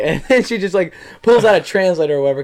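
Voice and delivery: normal talking voice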